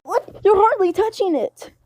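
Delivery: high, sharp what then a pout; outraged kid denied food; indignant surprise. Acted. Luffy protesting to the listener, high and indignant.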